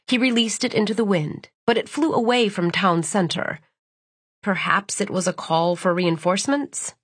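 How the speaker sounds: plain reading voice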